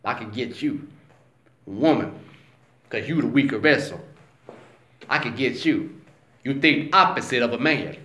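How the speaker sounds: low, hushed scheming devil; Satan scheming. Retold or imitated. Satan plotting to himself about Eve, in a low hush. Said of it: "he like".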